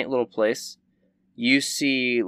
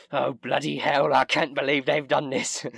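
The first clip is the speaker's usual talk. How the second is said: hammed-up English accent; badly faked English outrage; mock English accent; 5 semitones higher than usual. Imagined English listener appalled at the game, in mock English accent. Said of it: "there's some English person".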